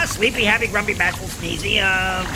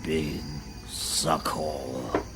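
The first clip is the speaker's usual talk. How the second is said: slow ominous; 8 semitones lower, 7 dB quieter, much slower than usual. Acted. Warning about the underworld.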